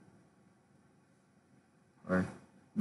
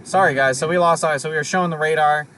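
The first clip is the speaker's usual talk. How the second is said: raised, higher voice over the storm noise; shouting over wind; 6 semitones higher, 5 dB louder than usual. Real, not acted. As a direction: call out over the wind to viewers. Livestream back on in storm.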